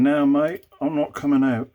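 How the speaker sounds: loud, high Aussie voice; playful Australian accent. Pretend. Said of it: "I tried to do, like, an Australian accent".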